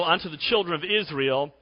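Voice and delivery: ordinary talk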